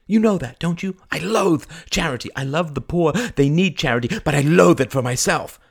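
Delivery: high, loud, insistent outburst; heated, proud insistence; vehement protest. Acted. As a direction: declare your loathing with force. Proud outburst refusing charity.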